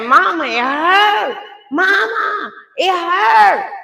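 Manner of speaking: high crying plea; imitating her daughter crying out in pain. Daughter in labor cries to her mother, high pained wail. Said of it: "she said".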